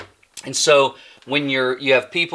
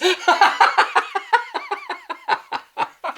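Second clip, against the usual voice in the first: long high-pitched laughing fit; uncontrolled giggling cackle; helpless laughter. Real, not acted. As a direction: laugh till out of breath. Laughing at the accidental hit.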